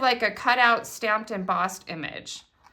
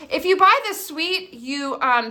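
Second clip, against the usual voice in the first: ordinary talk, nothing marked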